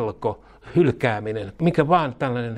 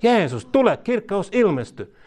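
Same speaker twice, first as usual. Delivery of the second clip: fervent cry; 9 semitones higher than usual. Retold or imitated. Preacher modelling a bold prayer, fervent high cry. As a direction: cry out for help in full faith. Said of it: "Mutta jos sanoo".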